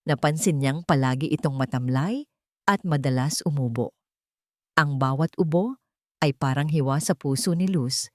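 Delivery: usual speaking voice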